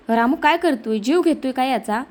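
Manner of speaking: ordinary delivery, nothing marked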